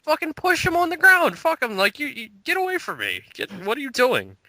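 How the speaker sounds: mock angry outburst. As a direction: shriek at the guy to get away, half joking. Acted rage at microphone shover.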